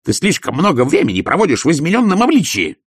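high, loud tirade; furious scolding. Acted. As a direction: tear into him like a shrewish wife.

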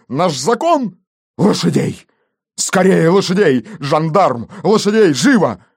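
high, impassioned fury; outraged vow. A narrator's reading. Police chief vowing revenge and demanding horses, outraged. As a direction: swear revenge, then fire off urgent orders.

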